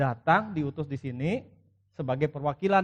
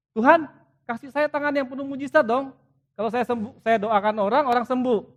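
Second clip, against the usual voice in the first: mock greedy prayer; 8 semitones higher, 7 dB louder than usual.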